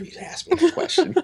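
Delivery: squeaky cartoonish mock voice; sly dealer voice. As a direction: play the grinning guy who was waiting for this. Dealer's knowing reply, acted quote.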